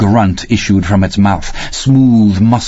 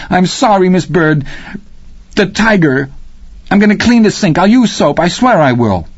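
put-on much higher, frantic little boy; pleading boy; 10 semitones higher than usual. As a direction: beg your way out of trouble.

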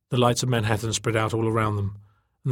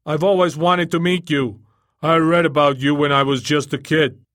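loud, higher, eager booming voice; 5 semitones higher, 7 dB louder than usual. A narrator's reading. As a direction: boom it like an admirer on loudspeakers. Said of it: "The volume diminished sharply".

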